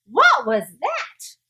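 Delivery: loud, slow, startled; alarmed question. Acted. Startled kitten asking about the mirror, loud and slow. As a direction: ask it wide-eyed.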